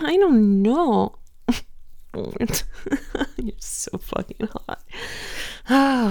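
ordinary talk, nothing marked

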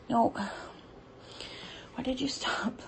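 disappointed whisper. Real, not acted. Whisper after he stops.

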